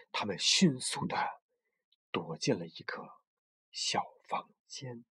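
hushed suspense whisper. A narrator's reading. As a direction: drop to a secret whisper. Hiding scene, suspense.

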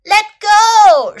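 high, very loud shout to be released. A narrator's reading. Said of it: "shouted Little Elephant".

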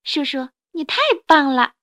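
happy shout. A narrator's reading. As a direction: cheer admiringly for your uncle. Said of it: "高兴地喊道".

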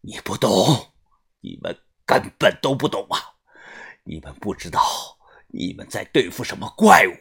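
低哑气声里猛然加重; 压着嗓子激动地说，末句扬高. A narrator's reading. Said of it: "突然激动". (低沉而激动地诉说)

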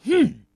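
loud scornful huff; angry hmph. Acted. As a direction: snort in contempt. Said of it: "暴躁先生哼了一声".

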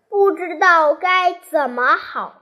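usual speaking voice

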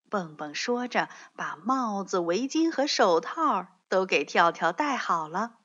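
ordinary delivery, nothing marked

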